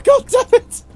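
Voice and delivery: hammed-up exasperated yell; mock outburst. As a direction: explode in fake frustration.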